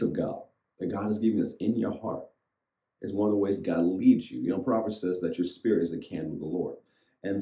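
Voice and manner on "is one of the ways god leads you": usual speaking voice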